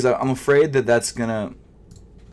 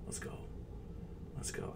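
begging whisper; 13 dB quieter than usual. Real, not acted. Begging the checkout to go through.

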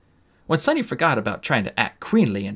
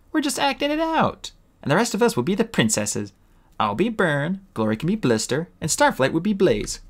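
put-on higher, brisk, bossy girl dragon; bossy young dragon; 8 semitones higher than usual. Tsunami assigning roles to friends, bossy and higher. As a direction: take charge of the game.

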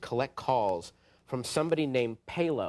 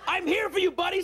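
eager; 14 semitones higher than usual. Retold or imitated. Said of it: "I was".

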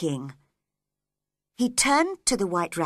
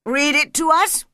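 loud, raised royal order; commanding king; 6 semitones higher, 7 dB louder than usual. Acted. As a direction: order it read before the court.

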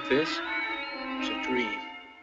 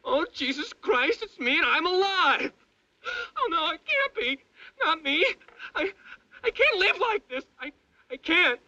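high, sobbing terror; nightmare moaning; 14 semitones higher, 7 dB louder than usual. Acted. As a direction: wail for help, crying and desperate.

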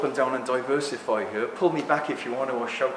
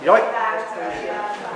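ordinary talk

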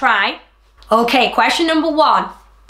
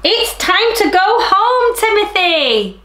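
dad calling; 7 semitones higher, 3 dB louder than usual. Shown as a demonstration. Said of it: "Timothy's dad said".

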